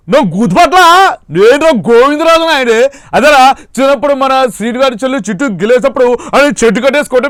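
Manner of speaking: loud, high village elder calling out across the yard; Govindarajula Naidu impression. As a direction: boom it out high and loud like a rustic headman. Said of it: "గోవిందరాజుల Naidu అనే పాత్ర చాలా అద్భుతంగా చేశారు".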